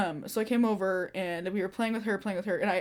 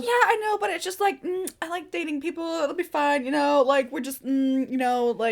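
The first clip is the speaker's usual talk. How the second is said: much higher dismissive friend voice; hammed-up high airy excuse; imitated breezy friend; 6 semitones higher than usual. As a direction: play her brushing off the worry in a high voice. Imitating friend Kat defending boyfriend.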